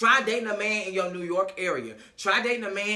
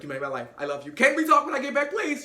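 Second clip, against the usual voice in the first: imitated pleading that leaps high; mock overwhelmed; 7 semitones higher, 4 dB louder, faster than usual. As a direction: play him overcome and pleading. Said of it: "so overwhelmed".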